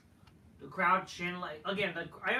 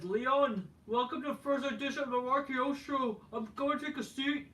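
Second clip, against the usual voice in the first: imitating Kevin Owens; 7 semitones higher than usual. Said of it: "Kevin Owens says".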